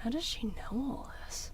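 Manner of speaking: whispered, disbelieving question; stunned whisper. Acted. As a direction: whisper it to yourself in dismay. Shaken aside under the taunts.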